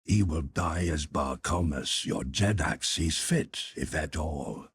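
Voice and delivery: low, measured, dignified reply; emphatic dignity. A narrator's reading. Young ruler answering his defiant lieutenant, low and dignified. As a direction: answer with quiet authority, pitched well down. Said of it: "replied the young ruler with emphasis and dignity".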